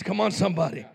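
ordinary talk, nothing marked

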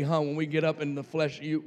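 ordinary talk, nothing marked

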